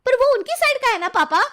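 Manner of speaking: very high, whining protest; protesting daughter. Pretend. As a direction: object to your father in a put-on sulk. Daughter protests to father in staged quarrel. (ऊँचे स्वर में शिकायत)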